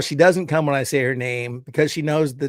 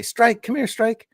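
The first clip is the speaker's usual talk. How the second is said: calling the cat; 8 semitones higher than usual. Retold or imitated. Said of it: "I'd say".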